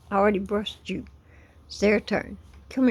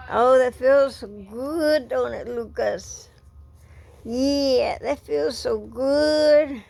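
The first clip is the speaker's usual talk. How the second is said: higher, drawn-out cooing; doting pet talk; 3 semitones higher than usual. Real, not acted. Owner brushing the pets, doting pet talk. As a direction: croon to a happy pet.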